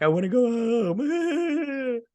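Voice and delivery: high, drawn-out fake bawling; mock crying. Retelling where she starts crying, exaggerated mock wail. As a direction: wail it like a hammed-up crybaby. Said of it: "this is where she starts crying".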